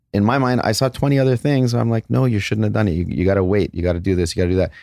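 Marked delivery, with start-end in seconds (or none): none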